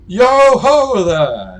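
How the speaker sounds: high, drawn-out loud call; hearty hail. Acted. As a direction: holler down from an airship window. Airship man hails from window.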